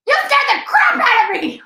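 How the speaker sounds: high, loud angry yell; startled yell. Retold or imitated. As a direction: blurt it out at her, startled. Woman yells after being hit.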